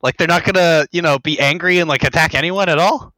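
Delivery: can't believe what they're seeing; incredulous. Retold or imitated. Venting disbelief at the film.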